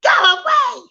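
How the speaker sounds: high mock scream; four-year-old screaming at a sibling, high; child screaming. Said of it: "Four-year-old starts screaming".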